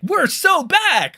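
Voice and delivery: high, loud, stretched-out hype shout; mock hype yell. Streamer mocking cool people's stream titles, mock hype yell. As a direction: holler it like an overexcited stream title.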